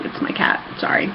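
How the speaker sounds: usual speaking voice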